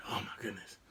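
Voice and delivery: very soft, low aside; nervous muttering. Retold or imitated. Driver to himself during the test, hushed dismay. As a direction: mutter it to yourself. Nervous thought while driving.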